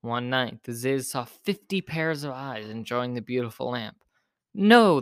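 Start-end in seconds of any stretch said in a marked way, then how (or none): 4.5-5.0 high, loud outburst; a sudden high squawk of refusal. A narrator's reading. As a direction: squawk it out in protest. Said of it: "the Ziz squawked".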